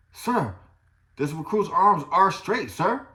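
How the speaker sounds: loud, formal recruit reply; barked sir yes sir. Retold or imitated. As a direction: snap it out like a recruit. Recruit answering drill instructor.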